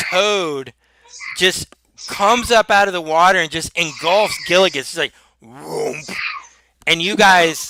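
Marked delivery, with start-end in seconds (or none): 5.4-6.1 soft gulping whoomp. Retold or imitated. Sound of toad swallowing Gilligan.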